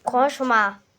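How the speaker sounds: loud, firm "don't talk"; sharp scolding. Real, not acted. Big brother scolding his little brother, loudly. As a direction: shut him up.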